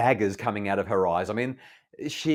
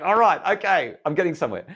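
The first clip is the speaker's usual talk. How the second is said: higher, louder, pleased burst; excited relief; 8 semitones higher, 6 dB louder than usual. Retold or imitated. Father realising his daughter is softening, excited and louder.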